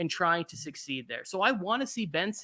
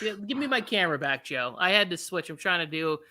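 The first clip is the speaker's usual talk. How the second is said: ordinary delivery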